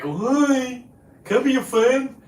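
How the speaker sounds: high, goofy mimicry of a needy newcomer, pitched well up; mock dummy voice. Mocking the boat newcomer who wanted friends, in a high goofy voice. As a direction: play the eager fool begging to join. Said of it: "That dummy on the boat that like".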